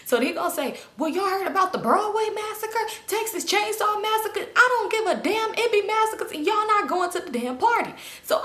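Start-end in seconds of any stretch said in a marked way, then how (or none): 1.0-7.9 high-pitched, exasperated dad imitation; worked-up dad. Dad refusing to drive the girls, high mock exasperation.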